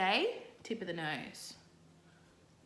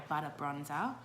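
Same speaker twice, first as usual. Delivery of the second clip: Australian accent, lower, relishing the sound; 6 semitones lower than usual. Real, not acted. Makeup vlogger relishing a favourite word, Australian accent. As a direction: savour the words in an Australian accent. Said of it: "satisfies my Australian accent".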